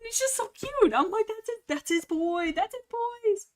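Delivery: high-pitched squeal of adoration; melting over something cute, very high; gushing. Real, not acted. Gushing over elders defending kit.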